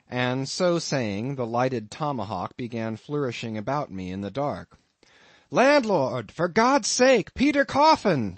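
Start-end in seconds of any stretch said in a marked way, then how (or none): 5.5-8.3 high, loud cry for help; panicked shout. A narrator's reading. Ishmael calling the landlord while threatened, panicked shout. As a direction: scream for the landlord in terror. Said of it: "shouted I".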